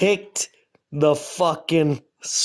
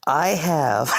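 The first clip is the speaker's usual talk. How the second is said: normal talking voice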